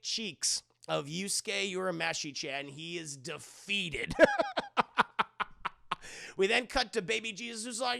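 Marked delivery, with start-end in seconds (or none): none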